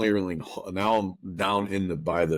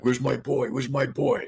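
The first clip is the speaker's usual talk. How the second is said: put-on higher-pitched, losing-it demand for his boy; retold frantic demand; 7 semitones higher than usual. Father demanding his missing son from a stranger, frantic and raised. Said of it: "I'm just losing it".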